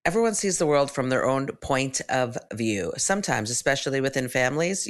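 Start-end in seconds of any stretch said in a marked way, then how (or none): none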